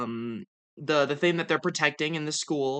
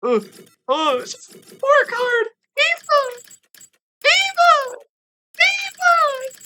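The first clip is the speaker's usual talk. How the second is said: extremely high squealed screams; freaking out; 6 dB louder, slower than usual. Real, not acted. Fan sees Ava on the report card, squealing ecstatically. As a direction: squeal the name louder in sheer delight. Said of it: "you missed me freaking out".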